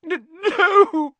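loud, high stuttered wail; very high, tearful cry; sobbing. A narrator's reading. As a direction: wail it out in sobs. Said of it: "sobbed Lavender".

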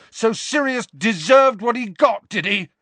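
very high, loud accusation; outraged yell. A narrator's reading. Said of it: "Harry yelled".

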